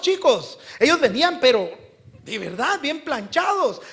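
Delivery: loud, high, emphatic sermon delivery; full-force preaching. Real, not acted. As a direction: drive it home to the congregation. (prédica a toda voz)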